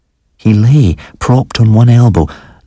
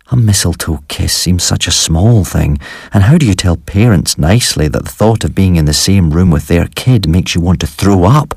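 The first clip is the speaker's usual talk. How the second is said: usual speaking voice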